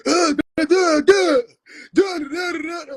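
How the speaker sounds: high, loud babbling mockery of a ranting prophet; hammed-up high-pitched duh duh ranting; mock screaming gibberish. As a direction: scream nonsense like a wild preacher.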